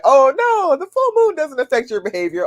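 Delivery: high-pitched mock denial; put-on naysayer voice, high; mocking doubters. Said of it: "People think that that's just one of those".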